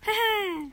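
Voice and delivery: short high greedy chuckle; gleeful laugh. Acted. Midas tries his new gift.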